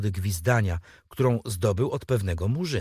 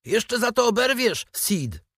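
put-on boy shouting a promise of revenge; calling out; 11 semitones higher than usual. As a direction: yell the threat back through the door. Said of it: "zawołał".

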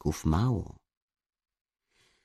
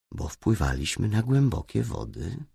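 usual speaking voice

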